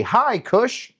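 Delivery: high, bright call of hi cush; cheery group greeting. Shown as a demonstration. Host shows the crowd how to greet him, calling out. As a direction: lead the crowd in a big hello.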